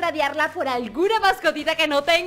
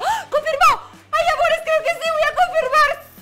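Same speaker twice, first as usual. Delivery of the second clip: much higher and louder, laughing shouts; ecstatic shout; 10 semitones higher, 6 dB louder than usual. Real, not acted. Vlogger celebrating the finally confirmed trade, shouting with joy. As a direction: celebrate at full voice.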